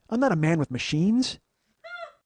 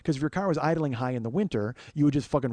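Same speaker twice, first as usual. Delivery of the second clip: ordinary talk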